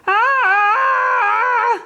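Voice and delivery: long high scream down the ramp; terrified race car scream. A narrator's reading. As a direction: scream all the way down. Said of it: "screaming all the way".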